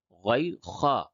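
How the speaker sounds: normal talking voice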